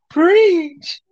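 high, loud, drawn-out exclamation; gleeful high-pitched holler; playful shout. Pretend. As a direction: belt it out high on the count. Shouted on count of three.

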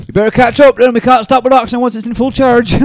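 loud, very high holler; pretend shouting back. Player shouting to the one running behind, loud high mock yell. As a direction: yell back from a speeding cart, hammed up.

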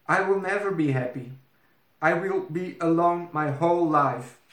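put-on high, fast despairing young princess; sad princess. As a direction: wail it like a heartbroken princess. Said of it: "that made her feel so sad".

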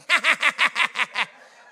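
imitated loud holy cackling laugh; loud cackle. Pastor imitating his mother-in-law cackling, loud cackle. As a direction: cackle it out big. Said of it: "She starts cackling".

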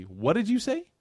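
high astonished question; a servant's startled question. Retold or imitated. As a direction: sound amazed at what you heard. Adas astonished by Bismillah.

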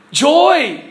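high, loud burst of joy; exultant pulpit cry; joyful exclamation. Real, not acted. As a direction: proclaim it with delight. Preacher exclaims the answer, rejoicing.

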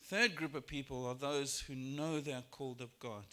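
ordinary talk

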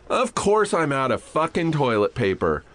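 acted-out sarcastic grumbling; sarcastic exasperation. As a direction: complain bitterly to an empty room. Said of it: "Audibly, I'll go".

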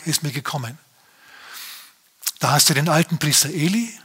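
ordinary talk

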